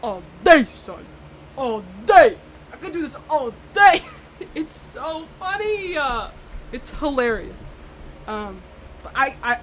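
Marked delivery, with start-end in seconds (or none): 0.0-4.0 loud, cocky taunt; a mild mock trash talk, loud and smug; imitating Schmidt. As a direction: brag like a smug guy showing off. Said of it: "Schmidt goes".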